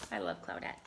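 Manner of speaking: low, soft loving aside; tender aside. Real, not acted. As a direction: say it fondly.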